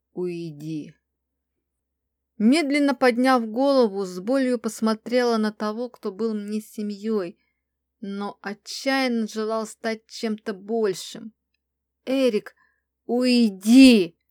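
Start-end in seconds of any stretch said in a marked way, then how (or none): 0.1-0.9 low, soft growl on an exhale; restrained growl. A narrator's reading. Angry mage tells her to go, low growl. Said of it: "выдохнул и рыкнул". 12.1-14.2 loud roar swelling on the last word; furious roar. A narrator's reading. Crown prince orders Erik out, roaring. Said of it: "взревел кронпринц".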